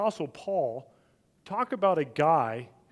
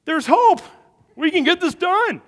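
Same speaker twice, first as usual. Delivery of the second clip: much higher, louder burst of excitement; sudden hope; 12 dB louder than usual. Retold or imitated. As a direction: cry out as hope breaks through. Dad's sudden hope watching son.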